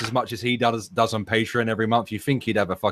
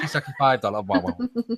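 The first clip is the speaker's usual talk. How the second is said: mock accented street hawker; 3 semitones higher, 4 dB quieter than usual. Quoting a movie prostitute line.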